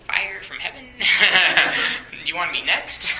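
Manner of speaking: high, laughing through the words; laughing disbelief. Retold or imitated. Mocking Jezebel's threat with a disbelieving laugh. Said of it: "Wouldn't you be thinking".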